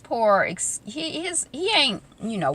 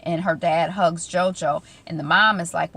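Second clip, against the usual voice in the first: ordinary talk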